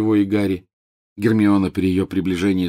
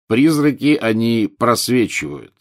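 mocking echo; 2 semitones higher, 4 dB louder, slower than usual. Teacher repeating the pupil's feeble answer, mockingly. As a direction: parrot the answer back, drawn out.

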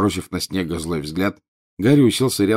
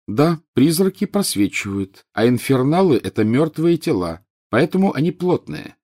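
higher voice, held in check; controlled calm; 6 semitones higher than usual. A narrator's reading. Pupil answering the mocking teacher, with forced calm. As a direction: answer evenly while holding back temper. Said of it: "спокойно продолжил, хотя внутри у него всё кипело".

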